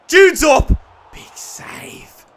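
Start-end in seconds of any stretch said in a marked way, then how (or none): 0.1-0.7 sudden loud, high yell; real excitement; excited shout. Real, not acted. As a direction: leap up yelling at the chance.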